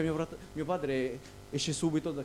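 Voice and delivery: normal talking voice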